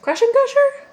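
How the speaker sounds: high, loud disbelieving question; surprised. Real, not acted. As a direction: say it like you can't believe it. Repeats ride name in disbelief.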